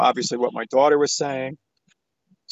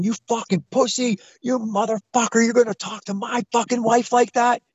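retold aggressive yell, pitched up; mock yelling; 6 semitones higher than usual. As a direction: imitate a psychotic husband's tirade. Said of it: "screaming, yelling".

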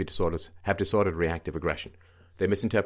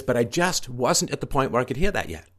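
higher voice, mocking her words; mocking quote; 6 semitones higher, 4 dB louder than usual. Host mocking the mother's excuse, higher and sneering. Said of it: "She says".

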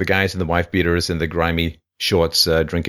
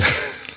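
short laugh; 3 dB quieter than usual. Real, not acted. Laugh after disgust.

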